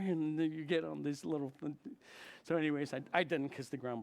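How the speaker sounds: usual speaking voice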